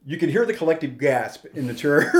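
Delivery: ordinary talk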